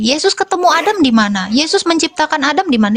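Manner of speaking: normal talking voice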